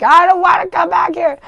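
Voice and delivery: high, loud mock wail about going home; dramatic whine. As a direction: wail it like a kid who won't leave. Quoting her own reluctance to return.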